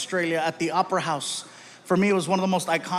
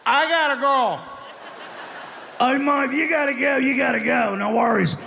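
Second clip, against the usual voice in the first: high, loud slurred Australian farewell; drunk Aussie; 7 semitones higher, 4 dB louder than usual. Retold or imitated. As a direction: slur it like a drunk Aussie mate. Drunk Aussie says goodbye.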